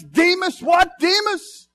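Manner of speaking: high shouted name call. Acted. Pastor calling a congregant by name, shouting. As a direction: call out across the room.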